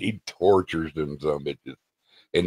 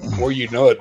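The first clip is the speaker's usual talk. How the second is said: usual speaking voice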